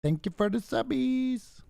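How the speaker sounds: squeaky high cutesy thank-you; high girly voice. Retold or imitated. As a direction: chirp it in a high girl's voice. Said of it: "Just invite Smeeve to Discord and say".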